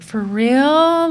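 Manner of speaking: high, loud disbelieving question; shocked, can't quite believe it; retold startled disbelief; surprised. Reacting to friends' warning.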